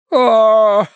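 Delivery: moan. A narrator's reading. Wife revives on the couch, moaning. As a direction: moan as if coming round from a faint. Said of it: "простонала миссис Меринг".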